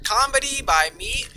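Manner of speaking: big booming commercial voice; loud ad announcer. Pretend. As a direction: sell it like a TV ad. Spoof ad continues.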